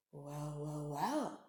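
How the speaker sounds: low, soft predatory musing; a hungry shark purring to himself; sly shark thinking. Acted. Shark eyes a singing narwhal, low and soft. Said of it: "he thought".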